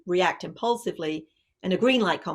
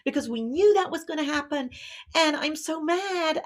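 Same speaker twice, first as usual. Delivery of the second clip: put-on upset teen rant; 9 semitones higher than usual. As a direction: let the daughter pour out her frustration in a high rush. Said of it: "then she says".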